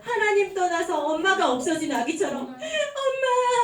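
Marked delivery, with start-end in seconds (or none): 2.4-3.6 put-on high, slow wailing baby cry of 엄마; drawn-out crying baby call; crying baby calling mom. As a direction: wail 엄마 like a lost baby. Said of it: "하고 울고 있는 아기처럼".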